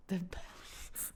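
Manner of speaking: hushed, near-whispered echo. Real, not acted. Hushed echo of co-host's praise.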